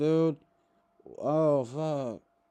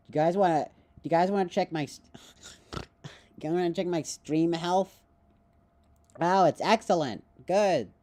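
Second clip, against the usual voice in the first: lightly voiced nerd, a touch higher; 4 semitones higher, 3 dB louder than usual. Streamer to chat, a hint of a nerd voice. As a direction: play a mild nerdy streamer. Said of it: "That was my nerd".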